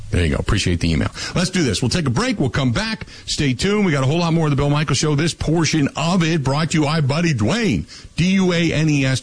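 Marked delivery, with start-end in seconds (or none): 1.4-9.2 high-pitched, upbeat announcer patter; radio break tease. Real, not acted. Host sending listeners to a break, radio announcer style. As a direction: pitch it up and sell the sponsor.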